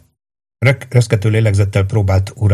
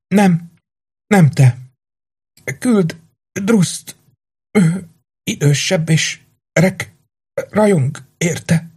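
fading dying woman; 10 semitones higher, much slower than usual. Acted. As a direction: let the breath run out as she speaks. Haldokló lány utolsó kérése. (magas, halkuló, lassuló utolsó szavak)

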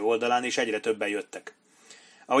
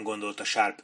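plain reading voice

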